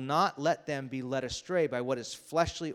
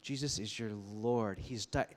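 a quiet inner whisper of love; gentle Spirit voice; 2 semitones lower, 6 dB quieter than usual. Retold or imitated. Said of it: "The Spirit says".

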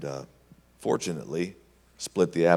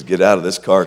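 loud order; firm command; 12 dB louder than usual. Retold or imitated. Tony ordering Charlie out of the car. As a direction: bark it at him. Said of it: "He says".

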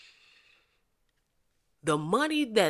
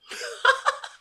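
high-pitched laugh at own joke; amused laugh. Real, not acted. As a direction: crack up, high.

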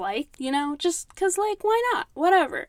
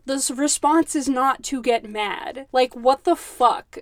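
normal talking voice

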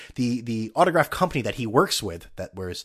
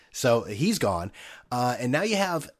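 ordinary delivery

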